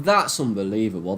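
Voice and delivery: ordinary delivery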